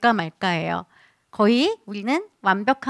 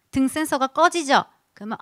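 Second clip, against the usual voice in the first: ordinary talk, nothing marked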